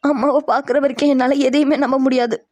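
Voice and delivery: weepy insistence; tearful distrust. Acted. Girl refusing reassurance until she sees mother, tearful distrust. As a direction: refuse comfort through acted tears. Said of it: "நீ அழுகாத".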